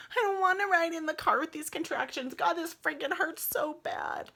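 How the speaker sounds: high, loud whine through a contraction; pained complaint. Retold or imitated. Labouring mother thinking about the car ride, pained complaint. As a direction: complain in pain, voice pushed up high.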